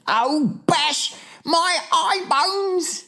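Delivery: very high, drawn-out wail; distressed cry. A narrator's reading. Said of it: "getting more distressed".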